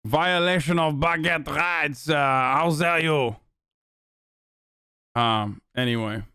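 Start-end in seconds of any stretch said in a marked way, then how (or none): 0.0-3.4 slow, affronted fake French accent; mock French accent. Streamer feigning offence over baguette rights, mock French accent. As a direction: draw it out in a put-on French accent. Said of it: "French accents".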